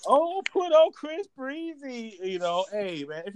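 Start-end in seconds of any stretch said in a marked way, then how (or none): 0.1-2.2 higher, drawn-out mock request; lilting mock girlish plea; lightly imitated girl. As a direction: slowly croon it as an eager fan. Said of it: "Who, who's like".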